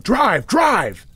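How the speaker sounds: high, loud shouted order; yelling. A narrator's reading. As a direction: yell it as an urgent command. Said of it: "they both started yelling".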